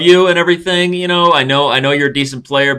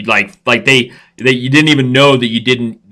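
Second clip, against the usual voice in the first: usual speaking voice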